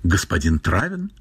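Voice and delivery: «Господин Травин?» with a rising question tune at the end; tentative query, «Господин Травин?» ending on a rise. A narrator's reading. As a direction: ask «Господин Травин?», lifting the voice on the last word. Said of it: "и сказала вопросительно".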